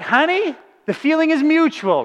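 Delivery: husband laughing back at wife; playful comeback. Retold or imitated. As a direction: toss it right back with a grin. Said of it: "I'm like".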